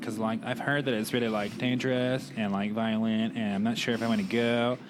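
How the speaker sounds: mock American tourist accent. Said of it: "when you do your American accent".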